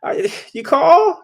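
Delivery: high, slow, drowsy mock voice; groggy bombshell just woken up; imitating a drowsy young wife; sleepy wife. Sleepy wife answers phone.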